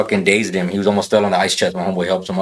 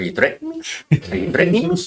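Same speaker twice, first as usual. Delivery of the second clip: much higher, cartoonish teen cackle-voice; Beavis impression; faster than usual. Mocking an internet tough guy's threat, high Beavis voice. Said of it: "Beavis when he said".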